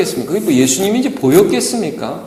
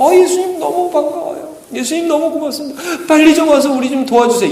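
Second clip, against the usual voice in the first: much higher, louder, eager cry of relief; excited greeting; 12 semitones higher, 6 dB louder than usual. Pretend. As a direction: beg for help, thrilled to see him. Imagined disciples greeting Jesus gladly.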